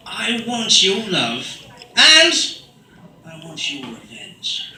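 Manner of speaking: slow, sexy spoken-word delivery; drawn-out seductive poem, mostly hushed with sudden swells; Russell Brand. Acted. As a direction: recite pop lyrics as sultry poetry, stretching each word. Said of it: "I can do Russell Brand".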